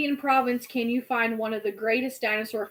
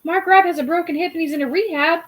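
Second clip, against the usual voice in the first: ordinary delivery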